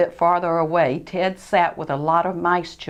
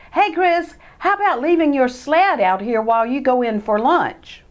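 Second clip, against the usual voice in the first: put-on higher, louder boyish mouse voice; Ted coaxing; 6 semitones higher, 5 dB louder than usual. Cousin Ted coaxing Christopher to leave his sled. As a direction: coax a cousin into it.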